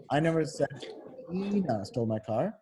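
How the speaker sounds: pause, then lean long on "Tina"; stress on TINA. Shown as a demonstration. Tutor showing someone else did it, stressing "Tina". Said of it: "That means someone else".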